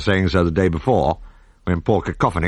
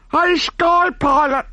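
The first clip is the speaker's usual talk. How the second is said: very high, slow call from the masthead; high, drawn-out sailor's call down; lookout calling down; slower than usual. A narrator's reading. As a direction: sing out from aloft. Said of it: "called the man in the crow's nest".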